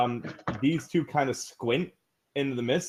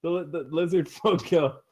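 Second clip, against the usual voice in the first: ordinary talk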